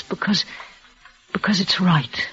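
breathless whispered plea; barely a whisper. Acted. Dying woman whispers to her lover to let go.

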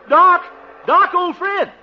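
high, loud call across the street. Acted. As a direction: hail him from afar. Calling out to old friend.

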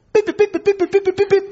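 high, clipped beeps like a phone keypad; high mock phone beeps; imitating dial tones. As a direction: beep out the number like a phone.

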